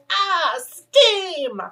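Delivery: high yelp, ah steam; voicing a burned hand. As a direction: squeal like a scalded hand. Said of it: "your hand's like".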